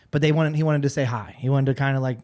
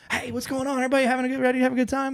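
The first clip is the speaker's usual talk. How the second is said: much higher, softer, eager party-guy voice; hyped-up greeting; 10 semitones higher, 4 dB quieter, much faster than usual. Retold or imitated. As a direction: imitate an overexcited country singer saying hello. Lee greets them, hyped up.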